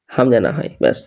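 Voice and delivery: normal talking voice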